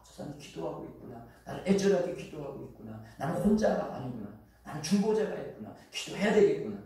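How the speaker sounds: ordinary talk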